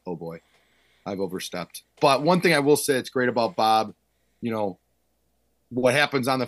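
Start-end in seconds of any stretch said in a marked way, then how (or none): 0.1-1.8 quiet mock dread. Cowed by Bob's stare.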